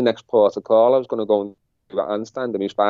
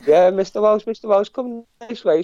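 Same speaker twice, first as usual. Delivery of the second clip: a light imitation of staff calling out, higher pitched; calling his name; 11 semitones higher than usual. Staff summoning him through the reception, a lightly voiced high call.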